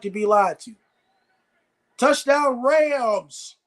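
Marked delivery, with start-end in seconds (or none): 2.0-3.6 high, slow, drawn-out scoring call; raised, lingering game call; touchdown call. Real, not acted. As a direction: stretch it out long like a touchdown announcer. Calling out a touchdown.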